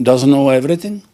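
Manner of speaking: normal talking voice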